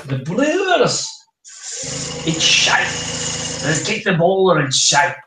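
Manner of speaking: a loosely put-on Scottish accent; Scottish brewer voice. Showing a friend how the Brewers talk, in a loose Scottish accent. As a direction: growl it like a rowdy Scottish brewer. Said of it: "the Brewers were more Scottish".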